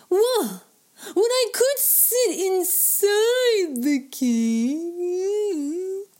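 loud, high yelps with stretched-out words; big, high, drawn-out excitement; excited yelp. A narrator's reading. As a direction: yelp with glee at your own idea. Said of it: "Choler yelped".

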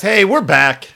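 loud, high welcome back; excited greeting. Real, not acted. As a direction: announce the return with energy. Podcast opening call.

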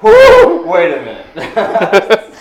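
loud high whoop then slow drawn-out words; startled whoop, stretch the rest; excited yelp. Retold or imitated. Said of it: "He's like".

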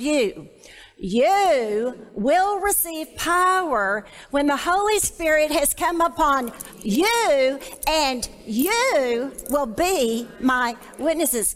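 slow, weighty recitation, "you" stressed; emphatic reading. Shown as a demonstration. Leading the group through the verse, slow and emphatic. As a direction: read it out slowly, leaning on "you". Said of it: "read this with me".

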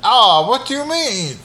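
high, innocent vampire voice; vampire playing dumb. Vampire dodging the donor's question, high and coy.

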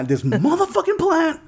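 high, comic movie-hero outrage; laughing, exasperated imitation; Samuel L. Jackson. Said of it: "Makes me think of Samuel L".

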